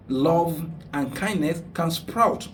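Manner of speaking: ordinary delivery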